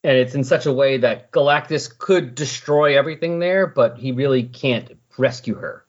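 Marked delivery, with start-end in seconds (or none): none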